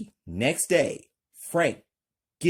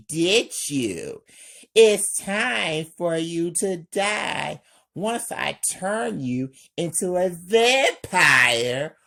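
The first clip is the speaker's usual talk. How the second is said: higher, louder menacing vampire taunt; evil villain; 7 semitones higher, 5 dB louder than usual. Acted. Vampire gloats over Frank, evil and loud. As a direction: gloat like a villain about to kill. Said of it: "Stain, evil".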